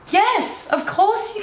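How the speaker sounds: thrilled to say yes to him; eager, delighted permission. Retold or imitated. Granting shy kid's request.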